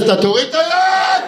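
high, loud, slow usher's shout; usher yelling. Retold or imitated. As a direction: bark at the crowd like a cinema usher. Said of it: "הסדרן תופס".

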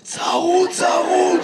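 straining out a hoarse word. Retold or imitated. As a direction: mimic someone who has lost their voice. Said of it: "למה אתה נשמע ככה".

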